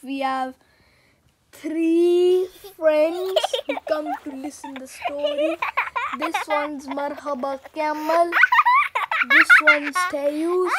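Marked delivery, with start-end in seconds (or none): 1.5-10.7 giggling introductions, higher; laughing. Real, not acted. Storyteller introducing toy friends to the audience, laughing.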